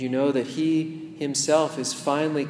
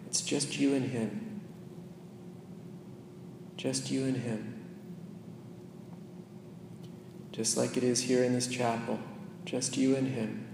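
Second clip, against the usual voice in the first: much softer, hushed guidance; intimate meditation hush; 2 semitones lower, 12 dB quieter than usual. Acted. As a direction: draw the listener into stillness with Jesus. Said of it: "leads a meditation".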